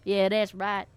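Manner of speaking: put-on slow Georgia accent; southern accent. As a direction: swagger in a southern drawl. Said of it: "the more I do a southern accent".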